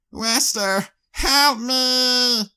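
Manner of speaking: very high, loud plea for help; terrified cry. Acted. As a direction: scream for him as she's pinned. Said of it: "she cried".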